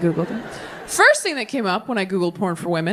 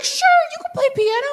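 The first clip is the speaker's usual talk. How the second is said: lightly mocked religious elder; mock church lady; 8 semitones higher than usual. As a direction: chirp it sweetly, then damn them. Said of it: "And they're like".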